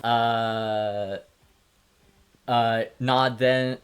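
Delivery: ordinary delivery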